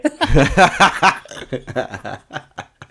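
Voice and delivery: long rising laugh. Real, not acted. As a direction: laugh along at the joke. Laughing at the joke.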